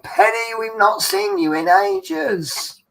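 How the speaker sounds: high, slow, sing-song greeting; waitresses greeting. Retold or imitated. Waitresses welcoming a regular back, high and slow. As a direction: coo it like delighted waitresses.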